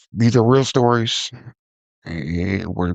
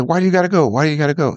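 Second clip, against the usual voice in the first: imitating upset dad; 7 semitones higher, 3 dB louder, much faster than usual. Father pleading as son leaves.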